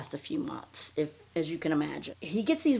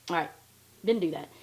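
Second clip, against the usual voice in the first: ordinary delivery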